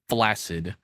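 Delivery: ordinary talk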